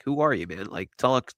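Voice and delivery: usual speaking voice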